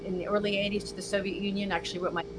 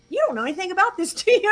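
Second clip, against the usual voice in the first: quick, high, laughing retold tease; 8 semitones higher, 5 dB louder, faster than usual. Student teasing the professor, laughing and quick. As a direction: mimic the student laughing at her. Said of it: "she laughed and she said".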